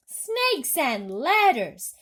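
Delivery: slow, loud, high exclamation; yelled. A narrator's reading. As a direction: bellow it out in dismay. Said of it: "yelled the head of the fire department".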